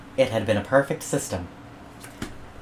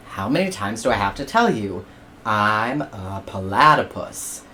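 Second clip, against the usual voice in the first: exasperated platypus; 3 semitones lower than usual. Acted. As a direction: sigh it out tired of asking.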